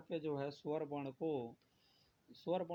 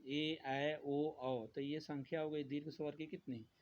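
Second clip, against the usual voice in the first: normal talking voice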